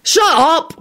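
high, sharp shout; exasperated high yell. A narrator's reading. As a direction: shout it to silence someone. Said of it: "William shouted".

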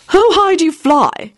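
high, slow, eager question; eager Quentin voice. Acted. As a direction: ask it wide-eyed and keen.

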